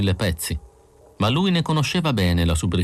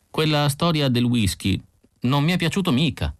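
higher-pitched, mildly plaintive protest; complaining; 6 semitones higher than usual. A narrator's reading. Isidor objecting to the whisky story, complaining. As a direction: lodge a wounded complaint. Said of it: "si lamentò Isidor".

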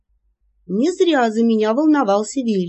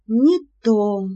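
dejected; 2 semitones higher than usual. Acted. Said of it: "удручённо произнесла я".

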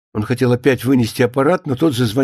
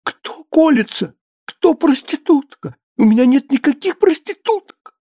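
very high, squeaky indignation; thin offended voice; 12 semitones higher than usual. A narrator's reading. Writer defending his characters to a caller, high thin offended voice. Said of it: "тонким от оскорблений голосом".

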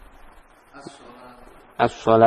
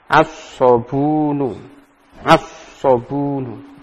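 slow model reading; much slower than usual. Shown as a demonstration. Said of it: "model reading repeated".

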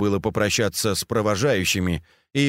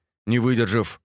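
ordinary delivery

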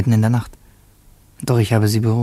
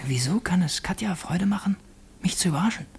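whispered puzzled aside; 5 semitones higher, 9 dB quieter than usual. Acted. Puzzled inner aside during talk.

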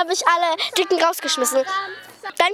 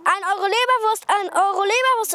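market vendor call; 5 semitones higher than usual. Retold or imitated. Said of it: "eine Frau hat gerufen".

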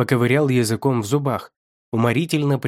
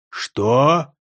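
loud, rising "what?"; loud shocked question; 4 dB louder than usual. A narrator's reading. Ху Вэй hears about the blood, loudly shocked. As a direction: burst out in disbelief. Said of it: "Это было так громко".

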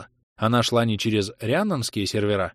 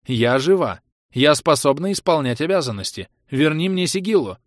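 indignant demand; 6 semitones higher, 5 dB louder than usual. Acted. Demands authority back.

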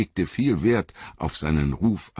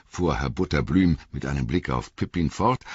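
ordinary delivery, nothing marked

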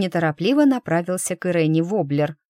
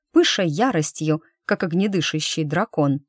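plain reading voice

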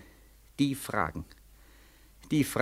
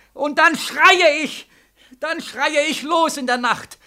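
higher, louder, slow choking cry; screaming; 8 semitones higher, 4 dB louder, slower than usual. Acted. Soldier relives his nightmare for the colonel, screaming. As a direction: scream it out as if suffocating. Dann schreie ich.

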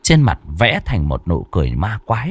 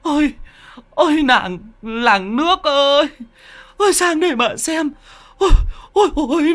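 high keening lament; bitter wailing. A narrator's reading. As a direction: wail to the whole village. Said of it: "gào lên chua xót".

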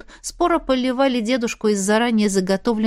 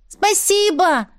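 high, slow call out; shouted thanks; 9 semitones higher, slower than usual. Acted. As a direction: call out thanks as you leave. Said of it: "крикнул Дэйм".

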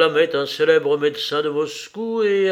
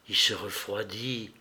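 softly, in a lowered voice; low voice; 5 semitones lower, 7 dB quieter than usual. A narrator's reading. Woman at the dying man's bedside, low voice. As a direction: say it low and hushed, under full voice. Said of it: "dit-elle à voix basse".

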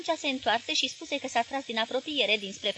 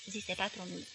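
ordinary delivery

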